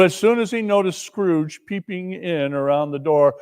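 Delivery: usual speaking voice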